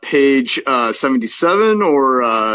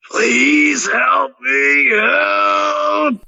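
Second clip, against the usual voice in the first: high, slow, wailing cry for help; pleading monster wail; desperate plea; 13 semitones higher, much slower than usual. Acted. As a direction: stretch it out like a creature begging for rescue. Monster begs in the reading.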